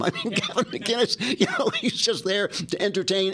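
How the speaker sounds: laughing while talking. Real, not acted. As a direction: chuckle through the words. Amused remark.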